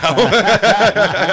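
big high burst of laughter; loud laugh. Real, not acted. Joking about his size now.

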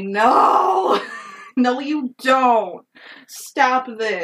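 hammed-up protest, begging her to stop; mock outraged no. Mock protest at Padme's confession.

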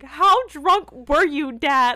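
loud, slow and high with a laugh; loud, slowed laughing disbelief; incredulous. Retold or imitated. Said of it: "I was like".

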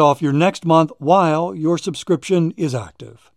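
upbeat sponsor read. Acted. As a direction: sell it like a radio spot.

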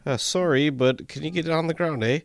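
apologetic order in a hammed-up Canadian accent. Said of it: "That's what Canadians sound like".

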